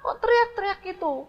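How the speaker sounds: husband yelling back at his wife, high and irritated; irritated shout back. Acted. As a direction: snap back at the wife over the boxing. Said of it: "balas juga dengan agak keras".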